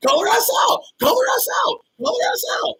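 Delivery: very high pitched yelling; shrill urging shout. Real, not acted. Urging a viewer to confront a woman, screeching. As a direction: screech the order over and over.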